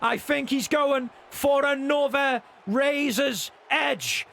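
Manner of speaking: ordinary talk, nothing marked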